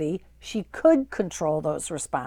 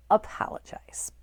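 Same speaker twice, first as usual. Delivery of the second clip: whispered. Real, not acted. Caregiver whispers the key advice to viewers, hushed. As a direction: breathe it like a secret.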